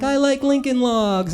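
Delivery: put-on little kid voice. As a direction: chirp it like a six-year-old. Kids introducing themselves in class.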